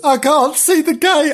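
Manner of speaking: high, desperate squeal; hammed-up frantic cry; panicking kitten. A narrator's reading. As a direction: wail it in a high voice.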